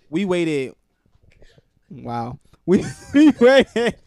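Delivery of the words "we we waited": hoog, luid lachend door de woorden heen; laughing. Real, not acted. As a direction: praat door je eigen lach heen. Laughing at his own slip.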